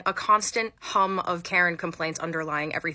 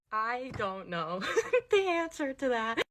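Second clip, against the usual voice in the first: high, slow words broken by laughter; real giggly bafflement; laughing bafflement; 4 semitones higher, 4 dB quieter, slower than usual. Real, not acted. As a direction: say it helplessly through laughs.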